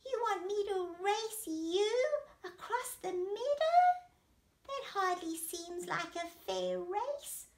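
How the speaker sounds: high-pitched, hesitant snail voice; doubtful little snail. Acted. Snail doubts the race with horse, high small voice.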